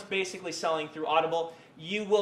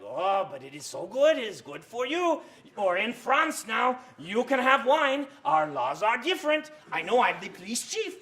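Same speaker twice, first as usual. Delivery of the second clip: a hammed-up French host dad insisting on wine; bad French accent; 6 semitones higher than usual. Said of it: "like the stereotypical bad Frenchman accent".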